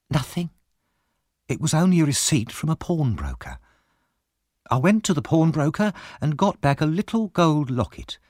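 Mrs Bumble. A narrator's reading.

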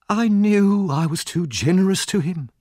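high, theatrical martyr; long-suffering wail, pitched well up; self-pitying lament. Acted. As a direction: sigh over your own generosity. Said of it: "raising her eyes to the ceiling".

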